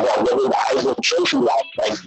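ordinary talk, nothing marked

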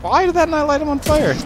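high whine. Real, not acted. Attack fails in game.

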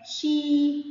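"شي" stretched as a long shee. Shown as a demonstration. Teacher showing pupils yaa lengthening, "شي" drawn out long. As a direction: hold the ee in "شي" steady. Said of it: "كسرة مد بالياء".

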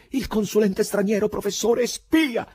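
high, loud, climbing to a cry; shouted answer. A narrator's reading. Poet naming the killer to the crowd, shouting. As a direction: fling each word out loudly.

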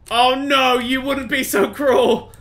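loud, high, drawn-out protest; dismayed cry. Real, not acted. Player protesting the game's cruelty, loud dismay. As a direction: wail it slowly in disbelief.

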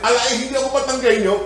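put-on fast, high Batangas-accented protest; Batangueño accent. Peter caught out by his speech, hurried Batangueño accent. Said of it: "that's the accent of a Batangueño".

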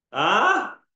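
ordinary talk

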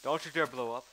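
loud warning at the creeper; yelling. Real, not acted. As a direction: shout it as a threat.

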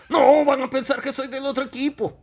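high, flustered comic voice; indignant high-pitched little character; protesting puppet character. Acted. Puppet Deca protests to host.

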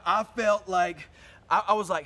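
ordinary delivery

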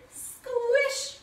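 high, stretched-out "squish"; playful squeeze. Pretend. Teacher giving herself a big hug, high playful squish.

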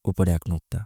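ordinary talk

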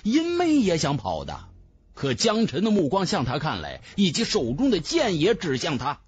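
usual speaking voice

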